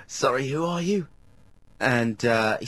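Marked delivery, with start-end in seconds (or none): 0.1-1.1 higher-pitched mock excited caller; excited bloke on the phone. Toilet bloke tells his mate the celebrity's back, mock excited. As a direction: imitate a chuffed bloke announcing a celebrity. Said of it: "he's going".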